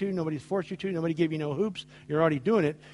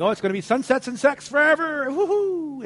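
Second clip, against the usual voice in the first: giddy newlywed; 9 semitones higher, 4 dB louder than usual. Retold or imitated. Mock newlywed dreaming.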